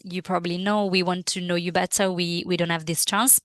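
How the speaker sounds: normal talking voice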